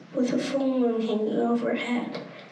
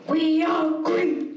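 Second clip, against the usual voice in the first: shouting together; 2 semitones higher, slower than usual. A narrator's reading.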